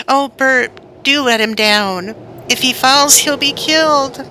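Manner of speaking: put-on high, urgent boy's begging; frightened plea. Little boy begging Bert before the kitten falls, high fearful plea. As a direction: plead in fear for the kitten.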